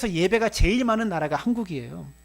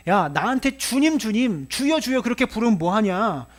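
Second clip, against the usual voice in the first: raised, higher-pitched scolding as the Lord; exasperated rebuke; 4 semitones higher, 4 dB louder than usual. Acted. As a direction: voice a master fed up with empty calls. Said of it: "이렇게 탄식하고 있다". (답답해서 꾸짖듯)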